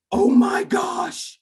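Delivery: slow, high-pitched astonished cry; mock amazement. Young teacher finds his trick worked, acted high astonishment. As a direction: draw it out, amazed at your own success.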